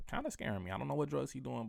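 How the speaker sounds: ordinary talk, nothing marked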